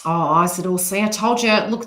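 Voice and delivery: ordinary talk, nothing marked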